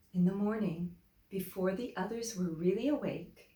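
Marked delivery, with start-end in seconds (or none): none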